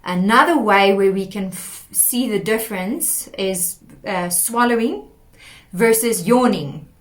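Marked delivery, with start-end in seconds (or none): none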